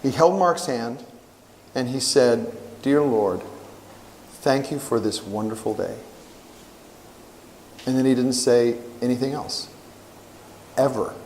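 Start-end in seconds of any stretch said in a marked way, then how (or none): 2.8-6.0 soft, lower, dying father's prayer; a hushed old man thanking God; quiet prayer. Retold or imitated. Dying father praying softly, hushed and grateful. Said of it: "I want to pray".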